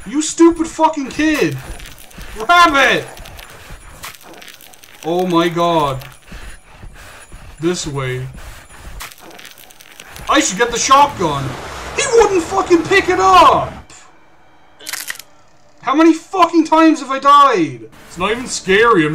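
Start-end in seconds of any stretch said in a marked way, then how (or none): 0.1-3.1 high, loud scolding shout; furious yell. Real, not acted. Player screaming at the game's kid companion, furious yell. 10.3-17.9 high, loud scolding shout; furious yell. Real, not acted. Player screaming at the game's kid companion, furious yell. Raging after repeated deaths.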